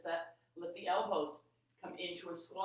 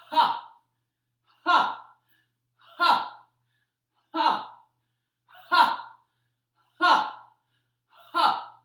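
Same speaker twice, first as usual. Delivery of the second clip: sharp loud Ha shouts; explosive breath-out shout; forceful shout; 9 semitones higher, 16 dB louder, slower than usual. Shown as a demonstration. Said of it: "let's pick up the pace".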